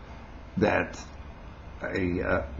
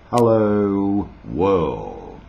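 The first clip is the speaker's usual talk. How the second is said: radio announcer greeting; 3 dB louder, much slower than usual. Retold or imitated. Recalling a Sydney radio host's opening, announcer voice. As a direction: imitate an old radio sign-on, stretching each word. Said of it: "a radio announcer in Sydney years ago".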